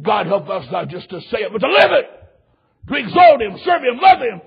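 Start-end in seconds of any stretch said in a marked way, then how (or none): 1.5-4.4 high, loud preacher's cry; fervent pulpit shout; exhorting shout. Real, not acted. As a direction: ring each phrase out to the congregation. Preacher exhorting congregation at peak.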